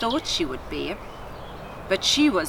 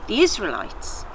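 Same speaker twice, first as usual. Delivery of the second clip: ordinary delivery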